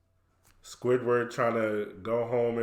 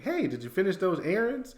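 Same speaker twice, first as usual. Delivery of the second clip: imitated SpongeBob; 8 semitones higher than usual. Imitating SpongeBob nagging Squidward.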